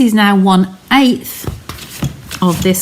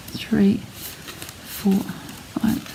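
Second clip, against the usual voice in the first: counting the sheets under her breath in a whisper; 12 dB quieter, much slower than usual. Real, not acted. Crafter counts the card sheets aloud, whispered. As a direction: murmur the numbers softly to herself.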